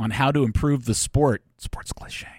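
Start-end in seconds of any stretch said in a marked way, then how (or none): 1.6-2.3 hushed, breathy aside; worried whisper. Acted. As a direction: whisper it like bad news. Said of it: "Why are we whispering?".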